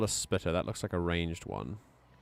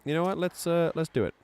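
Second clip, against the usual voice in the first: excited; 9 semitones higher, 5 dB louder than usual. Real, not acted. Deciding to take on enemies.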